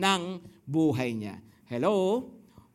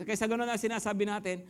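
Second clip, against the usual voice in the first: usual speaking voice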